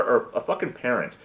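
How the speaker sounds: ordinary delivery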